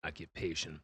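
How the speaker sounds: low-pitched, suave character voice; deep, self-assured game character; suave questioner. Acted. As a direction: drop well down and explain your rule smoothly.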